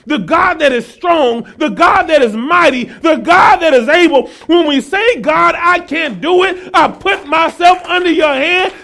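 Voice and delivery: rhythmic sermon build to a loud, high climax; full-force preaching, building. Real, not acted. Preacher rousing congregation to trust God's mighty hand, climactic preaching. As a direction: drive each phrase harder, then drop on the last.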